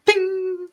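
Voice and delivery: high ringing ding; high metallic ding noise; high ding sound. Acted. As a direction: ring it out like a struck bell. GM sound effect.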